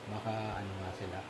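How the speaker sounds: ordinary talk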